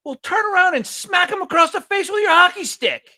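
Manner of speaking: high, loud, shrill-sounding mom voice egging him on; imitated hockey mom. A mom telling her son to hit the neighbor boy, high and loud. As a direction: yell it like a fierce hockey mom.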